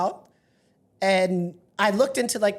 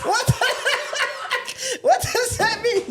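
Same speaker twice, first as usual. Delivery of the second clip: high, laughing disbelief; 13 semitones higher than usual. Real, not acted. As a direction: crack up while asking. Bursts out laughing at joke.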